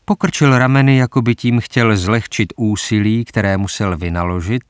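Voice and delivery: ordinary delivery, nothing marked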